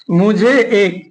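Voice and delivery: long pause before the last words; measured, broken-up dictation; slow dictation. Shown as a demonstration. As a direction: dictate it in slow chunks for note-takers. Writing out an example sentence.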